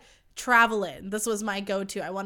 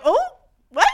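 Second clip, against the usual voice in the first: stunned shout; 14 semitones higher, 11 dB louder than usual. Retold or imitated. Reacting to being called gorgeous.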